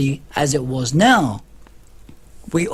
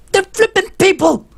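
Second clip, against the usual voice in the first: high, loud exasperated insistence; exasperated outburst; 5 dB louder than usual. Real, not acted. Defending refugees to viewers, exasperated high outburst. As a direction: burst out that they're people.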